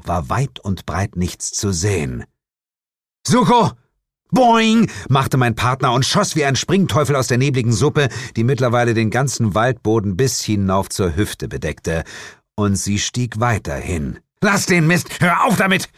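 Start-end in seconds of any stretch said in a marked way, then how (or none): 3.3-3.7 high, loud shout of a name; calling out. A narrator's reading. Detective calls for vanished partner, shouting. 4.3-4.9 loud, high-pitched boing; spring up with a cartoon boing; imitated spring noise. A narrator's reading. Partner pops out of the fog, loud boing. 14.4-15.9 high, exasperated yell; shouted scolding. A narrator's reading. Detective scolds clowning partner in fog, shouting angrily. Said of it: "rief ich".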